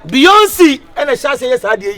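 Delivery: loud, high ranting; shouting. Acted. Hype man ranting loudly to the crowd. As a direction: rant loudly.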